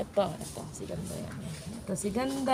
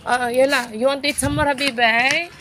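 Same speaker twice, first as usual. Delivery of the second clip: coaxing the cat; 4 semitones higher, 6 dB louder than usual. Real, not acted. Coaxing cat to eat more.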